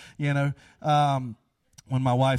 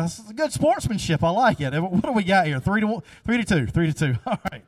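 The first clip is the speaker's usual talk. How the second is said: ordinary delivery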